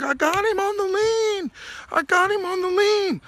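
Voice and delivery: a sore loser yelling he won; angry protest. Retold or imitated. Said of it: "screaming and yelling, all pissed off".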